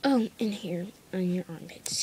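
ordinary talk, nothing marked

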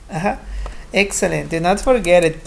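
ordinary talk

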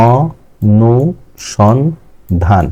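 অ-নু-সন-ধান in four slow, separate beats; syllable by syllable. Shown as a demonstration. Teacher showing a polysyllable, অ-নু-সন-ধান slowly broken into four. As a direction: pause between the syllables of অ-নু-সন-ধান. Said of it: "বেশি আছে, তিনের বেশি".

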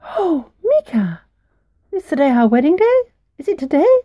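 high, squeaky and eager little mouse; a thrilled little mouse bride; tiny mouse voice. Acted.

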